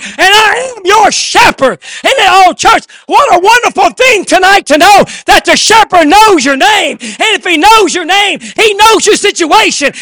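rousing pulpit delivery, building in pitch; impassioned preaching. Real, not acted. Preacher assures church the shepherd knows them, preaching with fervor. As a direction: preach in driving, repeated bursts.

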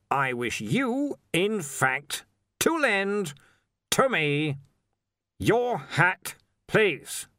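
slow, broken into deliberate chunks; slow, careful insistence. A narrator's reading. Arch-Chancellor insists on borrowing Stibbons's hat, slow and pointed. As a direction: spell it out through a fixed grin. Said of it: "slowly and carefully through his fixed grin".

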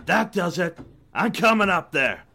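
angry shout upward. Acted. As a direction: done being patient. Guard losing patience.

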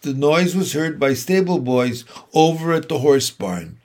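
usual speaking voice

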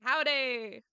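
loud hammed-up Southern howdy; imitating an American for laughs; mock American accent. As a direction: play a cartoon Texan. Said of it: "I will make fun of the U S now".

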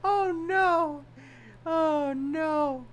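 tiny, very high wail; high-pitched dismay. Real, not acted. Podcaster reacting to own stuttering fit, high-pitched dismay. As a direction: squeak it out in drawn high dismay.

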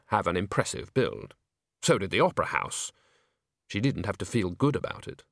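ordinary delivery

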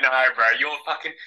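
usual speaking voice

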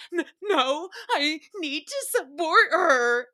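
slow, lightly voiced mock-earnest protest; a hint of a self-righteous friend; imitating Danielle. Mimicking Danielle refusing the producer's advice, slow mock-earnest. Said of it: "And she was like".

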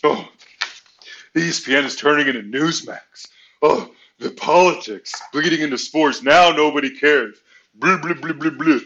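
a lightly voiced whiny complainer; mocking whiny voice. Mimicking everybody whining about ESPN politics, mock whine. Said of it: "what's boring is everybody saying".

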